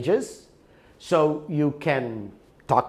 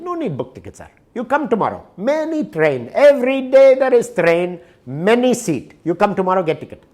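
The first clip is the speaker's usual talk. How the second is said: put-on Indian clerk accent; 6 semitones higher, 6 dB louder than usual. Indian ticket clerk quoted.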